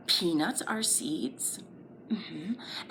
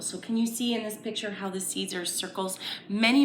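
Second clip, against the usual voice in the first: ordinary talk, nothing marked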